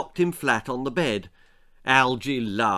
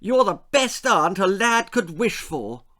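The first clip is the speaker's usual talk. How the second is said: put-on grateful boy; 8 semitones higher, 4 dB louder than usual. Col thanks aunt.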